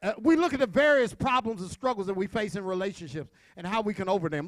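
ordinary delivery

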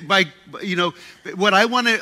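ordinary delivery

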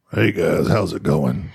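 very low-pitched greeting; deep voice. Shown as a demonstration. Host shows the friend a voice to try, deep voice. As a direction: drop right down into a deep voice. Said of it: "Lower your voice".

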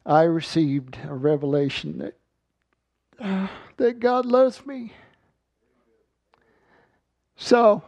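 high, strained and halting, moved to the core; choked up. Real, not acted. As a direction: voice rising high, overcome with emotion. Overcome recalling his revelation of God's love.

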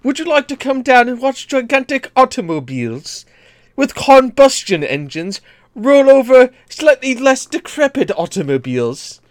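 high posh British announcer; hammy British ad read; British accent. Pretend. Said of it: "what is a British monster truck ad like".

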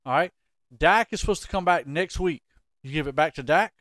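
usual speaking voice

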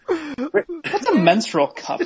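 high, slow, laughing confusion; laughing question. Real, not acted. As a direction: ask it while cracking up. Baffled question through laughter.

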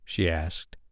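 ordinary delivery, nothing marked

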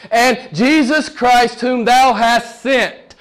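slow, weighty proclamation; emphatic scripture preaching. Real, not acted. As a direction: stretch each phrase from the pulpit.

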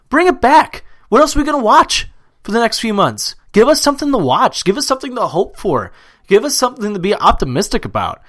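high, loud, fired-up demand; impassioned plea. Real, not acted. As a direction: argue hotly, pleading for the season back. Ranting against cancelling the season.

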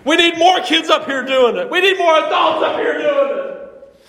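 a preacher getting carried away with joy; fired up. Real, not acted. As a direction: let the passion lift the pitch and volume. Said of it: "Sorry, I'm getting a little fired up".

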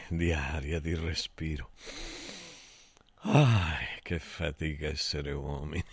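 breathless, catching air; out of breath. Real, not acted. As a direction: talk while short of breath. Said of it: "permettetemi di riprendere fiato".

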